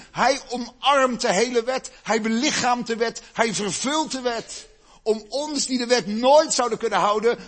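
rising pulpit fervour; preaching climax. Acted. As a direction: drive the point home from the pulpit. Sermon climax builds.

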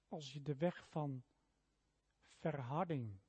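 ordinary delivery

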